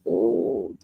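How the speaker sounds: normal talking voice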